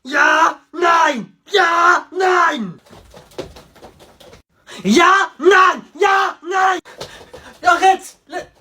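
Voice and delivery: hammed-up German shouting match; mock German shouting. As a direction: bark ja and nein back and forth. Yelling ja nein.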